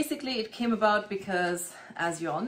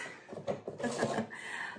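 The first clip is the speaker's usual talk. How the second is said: soft breathy laugh; 8 dB quieter than usual. Real, not acted. Laughs at her own nail comment.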